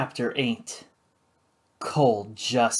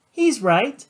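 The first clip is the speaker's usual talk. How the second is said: put-on much higher, giddy drunk voice; breezy and tipsy; tipsy woman; 9 semitones higher than usual. Flora to the judge, tipsy and giddy.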